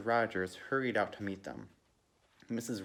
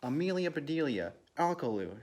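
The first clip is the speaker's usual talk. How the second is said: higher, exasperated call of names; mock shout; 6 semitones higher, 3 dB louder than usual. A narrator's reading. Hostess to the two with the hose, mild shout. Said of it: "shouted Missus Rogers".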